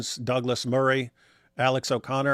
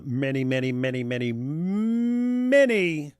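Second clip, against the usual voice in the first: exaggerated drawn-out many; slower than usual. Real, not acted. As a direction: pile it on, dragging the final word upward. Sarcastic exaggeration of a number.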